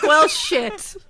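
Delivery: loud, high exclamation; mock realisation. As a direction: blurt it out as a comic reaction. Acts out the other's sudden realisation.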